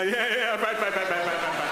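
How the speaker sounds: nod along happily; cheerful agreeing wife. Retold or imitated. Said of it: "she go".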